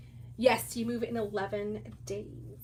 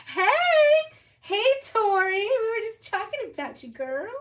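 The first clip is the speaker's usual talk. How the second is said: high, loud call to a friend arriving; excited greeting; 10 semitones higher, 9 dB louder than usual. Real, not acted. Greeting Tori as she joins the live, excited and high. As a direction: squeal her name out in welcome. Greeting a viewer who joined.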